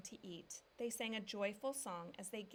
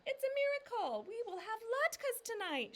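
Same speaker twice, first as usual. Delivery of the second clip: much higher and louder, jubilant; joyful shout; 8 dB louder than usual. A narrator's reading. Papa shouting to the family in jubilant joy. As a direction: shout it to the sky. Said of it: "shouted Papa".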